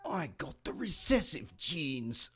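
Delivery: hammed-up English accent that comes out Australian; attempted English accent, drawn out, drifting Australian; English accent slipping into Australian. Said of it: "Oh, it sounded Australian".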